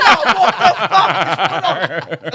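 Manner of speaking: loud, high, slow exclamation with laughter; hammed-up bewildered outburst; shocked reaction. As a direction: re-enact utter disbelief while cracking up. Acts his own shocked reaction.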